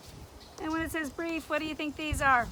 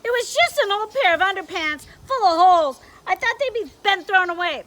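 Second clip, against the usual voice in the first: loud, higher excuses; defensive lizard; 6 semitones higher, 12 dB louder than usual. Acted. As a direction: plead your case hard. Leon excuses himself.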